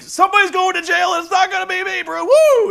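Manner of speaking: high, loud hammed-up Ric Flair shout; mock Ric Flair yell. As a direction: bellow it like a frantic Ric Flair. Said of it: "Rick is like".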